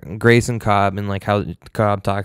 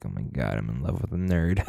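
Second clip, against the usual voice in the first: much lower, softer mock wife voice; imitating wife; 6 semitones lower, 8 dB quieter than usual. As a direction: drop way down for a teasing wife impression. Wife's reaction mimicked.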